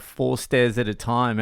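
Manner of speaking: usual speaking voice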